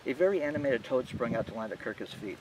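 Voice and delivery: usual speaking voice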